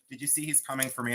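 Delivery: lightly voiced offended person, quick and hushed; quick hushed imitation of a touchy reseller; a hint of a miffed reseller; mock offended person. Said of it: "be all pissed off and say".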